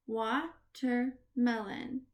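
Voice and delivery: Watermelon slowly broken into "wa", "ter", "melon"; pauses inside Watermelon; syllable by syllable. Shown as a demonstration. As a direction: clap out Watermelon in slow beats. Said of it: "we are gonna clap the syllable".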